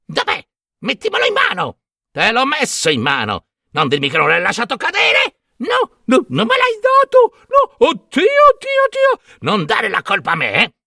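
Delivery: high, frantic squabbling crones; quarrelling hags. A narrator's reading. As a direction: bicker wildly in screechy high voices. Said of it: "continuando a litigare selvaggiamente".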